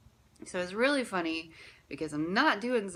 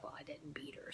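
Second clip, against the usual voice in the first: breathy self-talk under the breath; whispered thought; 3 semitones lower, 16 dB quieter than usual. Retold or imitated. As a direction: murmur it to yourself.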